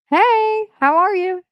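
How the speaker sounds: excited greeting. Real, not acted. Greeting a familiar viewer in chat, thrilled. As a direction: greet a friend you just spotted, thrilled. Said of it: "I just got excited".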